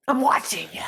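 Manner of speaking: a breathy whisper of I'm watching ya; the aunt teasing her niece in a whisper. Retold or imitated. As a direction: lean in and whisper it like a secret promise.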